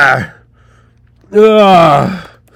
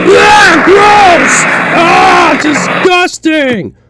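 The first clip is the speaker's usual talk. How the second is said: disgusted shout; 8 semitones higher, slower than usual. Acted. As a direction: shriek in disgust.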